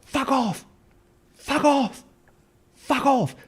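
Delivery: high shrill-free mock yell of the wife, far above own voice; repeated high yelled fuck off. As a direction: shout it like the furious wife. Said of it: "and she goes".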